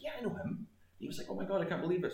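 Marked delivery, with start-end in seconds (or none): 0.0-0.7 excited reply. Retold or imitated. His own excited reply. 1.3-2.1 excited disbelief. Retold or imitated. Bar owner excited.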